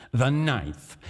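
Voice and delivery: ordinary delivery, nothing marked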